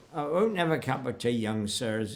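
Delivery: West Country countryman declining politely; imitated West Country speaker; West Country accent. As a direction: put on a rustic West Country burr. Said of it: "Afraid I'm using a West Country accent".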